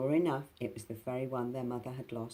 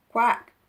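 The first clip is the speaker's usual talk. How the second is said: high, loud duck quack; 10 semitones higher, 15 dB louder than usual. Acted. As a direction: blast a quack. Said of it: "he said loudly".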